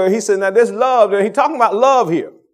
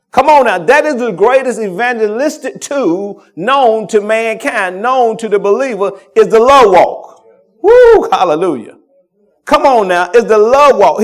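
louder, punchy preacher's call to the church; full-force preaching; 2 semitones higher, 7 dB louder than usual. Acted. Pastor rousing his congregation, full-force preaching.

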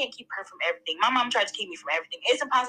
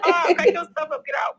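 loud, raised voice through laughter; retold mock scolding; 8 semitones higher, 5 dB louder than usual. Mother retelling how she told her kid to get out, laughing mock scolding.

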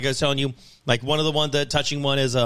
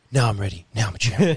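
low mock hero voice; 5 semitones lower than usual. Retelling a player pumped up.

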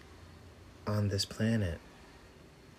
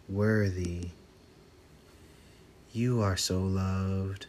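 guided affirmations. Acted. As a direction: let each line land with long pauses.

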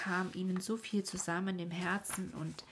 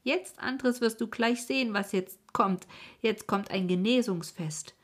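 louder, eager and meaningful; eager announcement; 4 semitones higher, 5 dB louder than usual. A narrator's reading. Miezchen rushes to her friend Andres with a surprise. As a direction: tease the surprise, beaming. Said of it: "mit bedeutungsvollem Winken".

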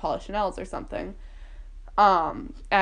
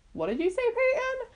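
high, climbing, unraised voice; disbelieving question. Retold or imitated. Crying kid checking what Peyton said, disbelieving question. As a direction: ask it high, rising on the name.

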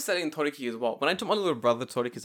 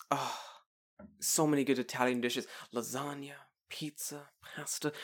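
soft, musing read of a menu; hammed-up browsing, dishes murmured one by one; pretending to deliberate; 8 dB quieter than usual. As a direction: ponder each dish under your breath. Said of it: "I will continue pretending that I don't know".

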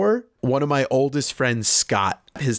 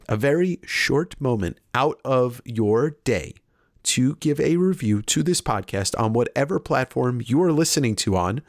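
ordinary delivery

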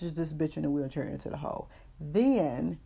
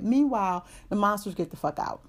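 usual speaking voice

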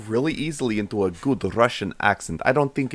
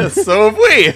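laughing; 10 semitones higher, 7 dB louder than usual. Real, not acted.

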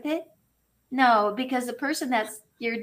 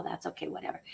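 whispered, softer, shrugging it off; a tossed-away whisper to yourself; dismissive whisper; 5 semitones lower, 13 dB quieter, much faster than usual. Retold or imitated. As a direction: let it slip out under the breath. Brushing off worry in self-talk.

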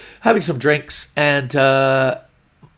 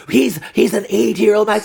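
flustered protest; 8 semitones higher, 5 dB louder than usual. Retold or imitated. Boyfriend protesting in acted quarrel.

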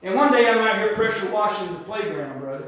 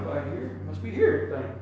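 low, much softer musing; mumbled to self; 6 semitones lower, 6 dB quieter than usual. Pretend. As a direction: shrug it off under your breath, chuckling. Imagined muttering to self.

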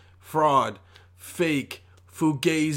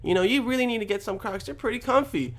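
put-on girlfriend voice; 7 semitones higher, 4 dB quieter than usual. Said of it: "She was telling me".

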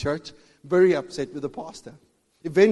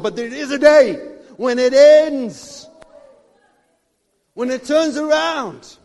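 much louder, very slow, drawn-out; climactic shout; 7 semitones higher, 12 dB louder, slower than usual. Real, not acted. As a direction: build to the breakthrough. Climactic preaching build.

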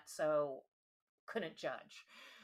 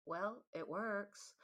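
softer, slow and sheepish; 5 dB quieter, slower than usual. Retold or imitated. Boyfriend to girlfriend after using the box, sheepish and softer. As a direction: mumble it like it's no big deal. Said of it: "and said sheepishly".

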